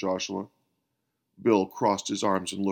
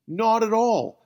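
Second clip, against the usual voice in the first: high, loud exclamation; emphatic outcry; exclaiming; 8 semitones higher, 5 dB louder than usual. A narrator's reading. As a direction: burst out in protest. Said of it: "exclaimed Bill".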